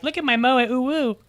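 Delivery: gushing anime fan. Retold or imitated. Mocking church now loving anime.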